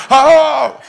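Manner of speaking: loud, high imitated guffaws; mock belly laugh. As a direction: imitate senior pastors bursting out laughing. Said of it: "막 웃으시는 거예요". (크고 높게 흉내 낸 박장대소)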